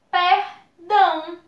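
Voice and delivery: "perdão" lento, per-dão, com o "ão" junto; palavra silabada. Shown as a demonstration. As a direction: diga "perdão" devagar para mostrar o ditongo. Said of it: "o A e o O juntinhos na mesma sílaba". ("perdão" silabado pela professora)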